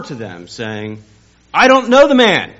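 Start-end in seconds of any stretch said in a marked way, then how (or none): 1.5-2.5 very high, loud yelled denial; cursing, shouted denial; furious shout. Retold or imitated. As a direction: scream it at them. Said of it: "began to call down curses".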